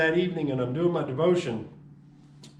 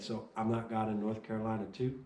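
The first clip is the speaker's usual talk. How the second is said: quiet calm; 4 semitones lower, 6 dB quieter than usual. Retold or imitated. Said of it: "as quiet and as calm as could be".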